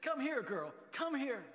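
calling the dog from across the street, high-pitched. Retold or imitated. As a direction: call out high to a dog you've found. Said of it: "and called out".

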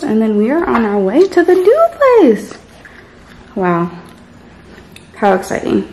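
talking while chewing gum. Real, not acted. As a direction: gum in the mouth, lips smacking. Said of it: "Sorry, I have gum".

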